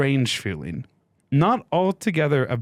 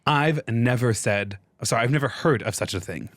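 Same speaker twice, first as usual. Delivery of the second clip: ordinary talk, nothing marked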